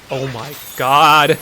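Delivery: slow, drawn-out dismay; awestruck horror. Acted. Jeff seeing hell around him, drawn-out mock dismay.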